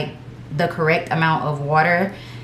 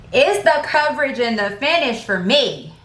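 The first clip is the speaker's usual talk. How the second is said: raised, higher-pitched exclamation; yelling; 6 semitones higher than usual. Real, not acted. Praising the foundation's coverage and finish to viewers, yelling. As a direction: call it out emphatically, pitch lifted. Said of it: "Why am I yelling?".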